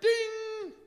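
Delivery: high, bright chime-like ding; mock bell ding. Holy Spirit answering prayer, mock bell ding. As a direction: ring it out like a bell. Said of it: "the Holy Spirit's like".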